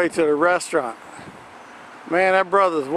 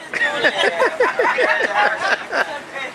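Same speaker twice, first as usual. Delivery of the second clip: high laugh; 11 semitones higher than usual. Real, not acted. Laughing at Jesse's outfit.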